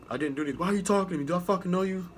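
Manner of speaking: imitating an offended recruit; indignant recruit. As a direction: act out a recruit snapping back. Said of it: "Like, just like that".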